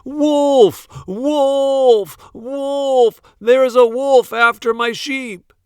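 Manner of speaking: high, loud alarm cry; yelling. A narrator's reading. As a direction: scream for help like the wolf is here.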